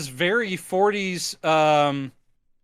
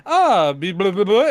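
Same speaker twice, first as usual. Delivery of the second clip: lifted, louder mock-French babble; countess speaking French; 2 semitones higher, 5 dB louder than usual. The countess testing the creature's French, lifted mock babble. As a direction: babble brightly in pretend French. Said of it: "And she goes".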